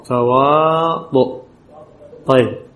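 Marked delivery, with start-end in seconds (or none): 0.0-1.4 تواطؤ drawn out slowly, ending on the final u; slow demo word. Shown as a demonstration. Teacher slowly spelling out a word for students, drawn out. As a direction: stretch تواطؤ slowly for the class. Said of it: "أكتبها على إيش؟".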